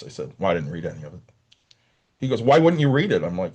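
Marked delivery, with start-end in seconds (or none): 0.4-1.2 low, offhand retold answer; indifferent student reply. 2.4-3.2 louder, higher mock coach; retold scolding. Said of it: "Are you yelling at him too".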